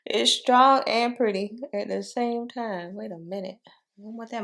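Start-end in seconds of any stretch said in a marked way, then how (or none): 0.0-3.0 loud laugh, then slow amused words. Real, not acted. Laughs at a strong blush.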